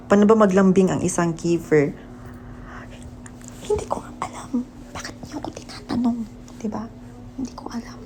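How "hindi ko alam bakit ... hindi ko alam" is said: high soft whisper. Real, not acted. Asked how to be sweet.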